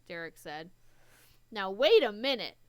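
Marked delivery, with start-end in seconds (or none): 1.5-2.6 loud protest. Acted. Protesting against being kicked out.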